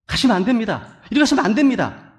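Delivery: rising, insistent plea to stop; urgent warning. Acted. A friend warning you off the path, urgent acted plea.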